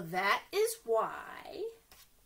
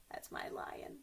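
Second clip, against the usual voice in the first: whisper; 12 dB quieter than usual. Real, not acted. Aside after puppet roar.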